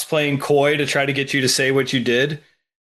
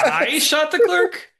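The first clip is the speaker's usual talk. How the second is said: high, slow, puzzled I shot the clerk; weird high question; 13 semitones higher, slower than usual. Retold or imitated. Mocking the kid's odd line, high and puzzled. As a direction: ask it like a baffled kid, pitched up. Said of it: "his weird, like".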